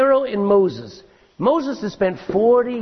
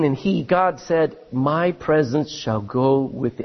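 solemn scripture reading; 4 semitones lower, slower than usual. Acted. Reads scripture aloud slowly.

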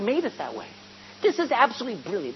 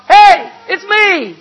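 calling out; 11 semitones higher, 13 dB louder than usual. Retold or imitated. Jesus calls out to frightened disciples in the boat, loud and high. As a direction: shout it over the wind and waves. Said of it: "A voice cries out".

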